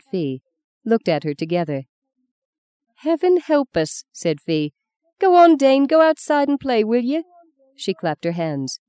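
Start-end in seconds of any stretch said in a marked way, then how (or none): 3.0-4.0 high-pitched exasperated exclamation. Acted. Fee exasperated by the children. 5.2-7.2 high, loud, impatient shooing; brisk grandmother's order, pitched high. Acted. Fee shoos the children outside.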